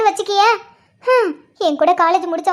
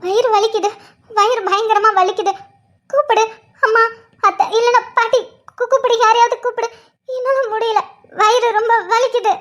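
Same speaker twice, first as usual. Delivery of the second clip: gasping, broken pleas; in pain. Acted. Pregnant wife begs husband to fetch the family, in pain. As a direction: plead through waves of pain. Acted labour pain, begging for help.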